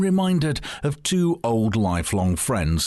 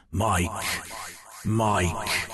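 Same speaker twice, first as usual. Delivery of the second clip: ghostly calling voice; 4 dB quieter than usual. Acted. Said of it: "a voice calling his name".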